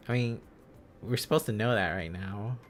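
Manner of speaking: ordinary delivery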